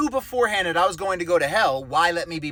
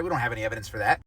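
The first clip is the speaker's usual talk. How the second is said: low, soft, mocking aside; quiet sarcasm; 7 semitones lower, 4 dB quieter, much faster than usual.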